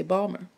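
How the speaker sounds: "Balmer" said ball-mer, the T and I dropped; "Balmer" the Baltimore way, two syllables; local Balmer. Shown as a demonstration. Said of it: "people in Maryland will say Balmer".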